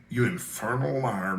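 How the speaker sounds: low, slow murmur under the breath; low slow muttered insult. A narrator's reading. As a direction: mutter it slowly as an aside. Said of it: "murmured Tarp Henry".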